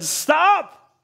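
sharp high call to stop; angel's shout. Retold or imitated. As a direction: cry out to halt the knife. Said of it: "an angel said".

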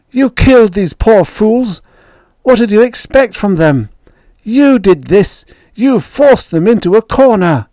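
high, loud, furious outburst; impassioned high-pitched tirade; shouted accusation. A narrator's reading. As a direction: shout the blame at them. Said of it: "she shouted".

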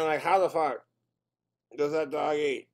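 normal talking voice

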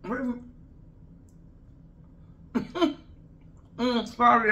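muffled through fingers; talking while chewing; hand over mouth. Real, not acted. Seen 0: Fingers cover her mouth through the labelled words, mid-bite, eating.

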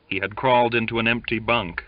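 ordinary delivery

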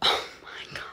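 whispered disbelief after a laugh; hushed, voiceless amazement; awed whisper. Real, not acted. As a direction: whisper it, overwhelmed. Overwhelmed by size of the order.

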